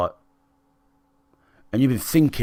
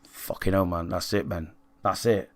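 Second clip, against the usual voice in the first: much softer, resigned words to himself; muttered inner thought; 4 semitones lower, 9 dB quieter than usual. Retold or imitated. Telling himself off quietly after his girlfriend's ultimatum, much softer. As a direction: say it under your breath, giving up.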